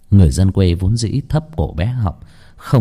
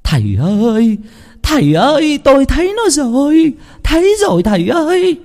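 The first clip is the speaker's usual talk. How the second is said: excited calling out; 4 dB louder than usual. A narrator's reading. Woman rushing in to tell the master, excited shouting. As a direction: burst in hollering at the top of your range. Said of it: "mụ ba đã sồng sộc vào".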